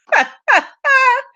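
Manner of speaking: high, helpless laughing burst; burst of laughter. Real, not acted. Host cracking up at a viewer's joke, high burst of laughter. Laughs at comment just read.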